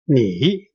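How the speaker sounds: "你" dipping down, then up; low falling-rising "你"; third tone. Shown as a demonstration. Teacher showing the first word, "你" in third tone. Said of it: "that's a third tone".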